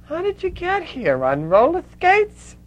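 high, loud, thick Brooklyn lispy voice; Lena. Retold or imitated. Lena questions the visitor from Calcutta, thick Brooklyn lispy voice. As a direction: heckle like a lispy Brooklyn character, dragging the last words. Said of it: "in this very thick Brooklyn lispy voice".

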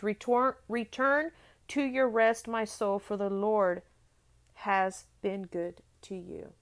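ringing sermon reading; fervent scripture reading. Acted. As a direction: preach the verse with conviction. Reads the psalm aloud in sermon.

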